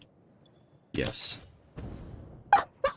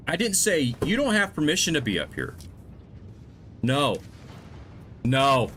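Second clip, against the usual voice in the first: higher, firm telling-off; scolding; 6 semitones higher than usual. Real, not acted. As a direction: lay down the law to the dogs. Telling dogs off.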